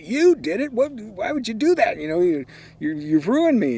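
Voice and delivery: very high, loud, stammering accusation; outraged disbelief. Retold or imitated. Accusing brother Bob of ruining him, high and loud. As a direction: squeal it out like your career just ended.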